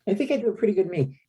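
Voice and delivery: quick, high-pitched host impression; Jay Leno. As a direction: toss back the reply in a put-on celebrity voice. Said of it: "you do a lousy Jay Leno".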